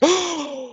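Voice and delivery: high theatrical gasp; mock shocked gasp. Host imitating everybody seeing the bite, shocked gasp. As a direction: gasp like a whole scandalized room.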